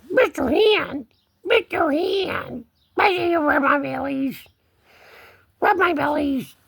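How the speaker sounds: high, pleading kitty talk; cat voice. Pretend. Cat begging a hand for belly rubs, high cat voice. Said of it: "the only cat voice I have".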